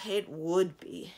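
slow, loud sigh of disgust; sigh, then drag it out in disgust; heavy, disgusted resignation; deep disgust. A narrator's reading. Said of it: "in tones of deep disgust".

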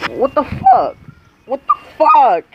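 outraged disbelief. Real, not acted. Repeats in rising outrage.

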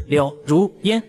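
柳如烟 split into three separate syllables; 柳如烟 with a deliberate pause after each character; word by word. A narrator's reading. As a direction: say 柳, 如, 烟 one at a time. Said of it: "我一字一顿".

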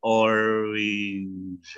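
"orange" stretched out slowly, syllable by syllable; slowed down. Shown as a demonstration. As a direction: drag out "orange" slowly for learners. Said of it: "kalau dipelanin sama coach Chan". (ucapkan "orange" pelan-pelan)

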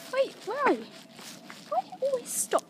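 high, loud call to the dog. Real, not acted. Calling the dog on walk.

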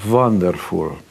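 put-on light Hungarian-accented Wonderful with a V sound; imitating his Hungarian mother's broken English. Son mimics his mother reacting to the check, light Hungarian accent. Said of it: "she said in her broken English".

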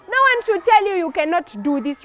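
ordinary delivery

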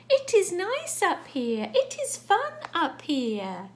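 high, loud, slow singsong coaxing; coaxing animal voice. Acted. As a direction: call down invitingly, stretching the words. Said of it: "It says".

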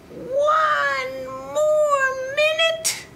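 high, slow whiny farmer; a lightly voiced sleepy farmer. Sleepy farmer begs for time.

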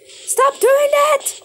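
high, loud, angry yelling; calm turning angry. Real, not acted. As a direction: yell at them furiously. Friend attacking him in game.

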